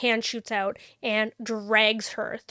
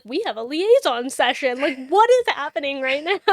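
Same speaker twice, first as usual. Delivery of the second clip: exasperated shout; 3 semitones higher, 7 dB louder than usual. Retold or imitated. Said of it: "he's like".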